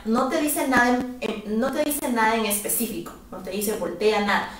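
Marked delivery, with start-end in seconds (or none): none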